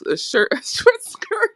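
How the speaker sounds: high, laughing through the joke. Retold or imitated. Retelling the friend's joke about her skirt, laughing. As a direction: break into laughter on the words. Friend joking she looks like hooker.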